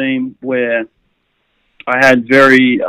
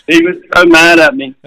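laughing; 3 dB louder than usual. Real, not acted. Laughing while recalling Grant's anger.

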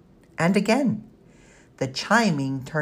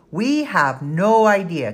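louder, slow sullen reply; 5 semitones higher, 6 dB louder, slower than usual. A narrator's reading. Danny answering her cousin, sullen and slow. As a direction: drag it out, resentful. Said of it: "came the sullen response".

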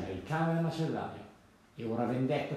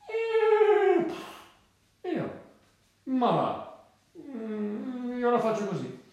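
high, loud whinnying horse voice; storybook horse, indignant and whinnying; 8 semitones higher, 5 dB louder, much slower than usual. Acted. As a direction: neigh and snort into the words.

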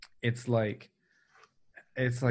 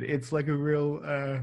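mid-yawn, words stretched; 3 semitones higher than usual. Real, not acted. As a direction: talk through a yawn. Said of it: "sorry, I'm yawning a lot".

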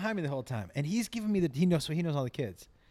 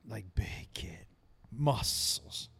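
whisper; 6 semitones lower, 6 dB quieter, much slower than usual. Real, not acted. Describing athlete in hushed awe.

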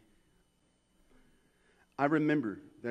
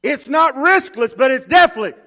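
emphatic preaching; 13 semitones higher, 20 dB louder than usual. Acted. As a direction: lean hard on riskless.

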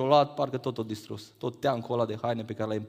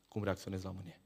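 hushed emphasis; 6 semitones lower, 14 dB quieter than usual. Shown as a demonstration. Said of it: "Atenție mare!".

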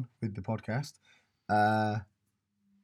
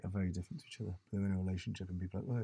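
ordinary delivery

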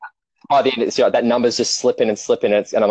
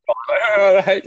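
high whiny complaint; 9 semitones higher, much slower than usual. Retold or imitated. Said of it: "I'm like".

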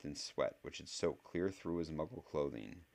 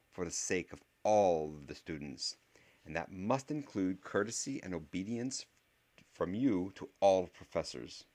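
stern witch voice; 3 semitones higher, 5 dB louder than usual. Acted. Voiced professor character.

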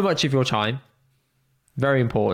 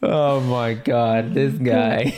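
amused disbelief; much slower than usual. Real, not acted.

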